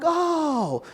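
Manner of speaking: high, drawn-out retold "oh"; dawning recognition. Man suddenly remembers the caller, high and surprised. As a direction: let it dawn on you, pitched high.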